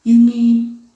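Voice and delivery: loud astonished gasp of a question; startled elephant, loud and wondering. A narrator's reading. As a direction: blurt it out in amazement. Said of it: "Horton gasped".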